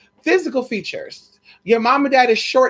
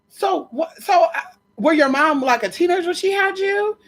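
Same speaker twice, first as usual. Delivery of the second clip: retold shock; 9 semitones higher than usual. Retold incredulous question.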